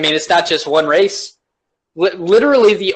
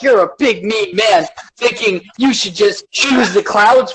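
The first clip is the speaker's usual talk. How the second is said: slow, higher, whiny accusation; put-on childish indignation, words drawn out; mock offended scold; 4 semitones higher than usual. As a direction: play an oversensitive accuser. Mocking the oversensitive accusers.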